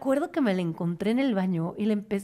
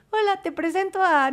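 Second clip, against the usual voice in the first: sugary greeting; 9 semitones higher than usual. Retold or imitated.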